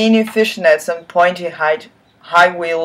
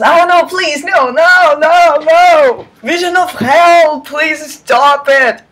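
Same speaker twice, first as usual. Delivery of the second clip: mock horror; 9 semitones higher, 4 dB louder than usual. Real, not acted. Reader begs in mock horror.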